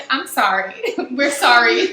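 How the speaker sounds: loud, drawn-out playful apology; hammed-up, stretched-out sorry; mock apology. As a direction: apologize loudly with a laugh. Mock apology to the younger generation.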